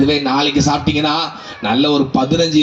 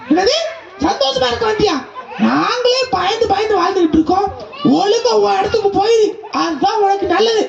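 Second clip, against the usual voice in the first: much higher, half laughing scolding; high animal voice telling the goat to leave; high giraffe warning. Acted. Giraffe warns the goat to go home, high voice.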